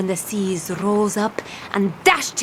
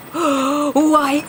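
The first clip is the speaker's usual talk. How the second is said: gasp; 3 semitones higher than usual. Acted. Discovering the Gearbeard positioning system.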